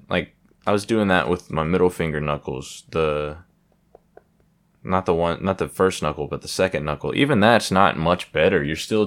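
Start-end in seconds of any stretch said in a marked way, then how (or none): none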